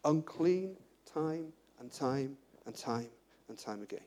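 slowing right down and dropping away to almost nothing; slow fading repetition. Acted. Preacher to congregation, repeating unclean, slow and fading.

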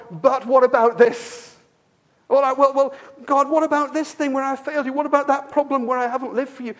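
an insistent mock plea; anxious believer pleading. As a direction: protest as a worried believer. Believers voicing doubts to God.

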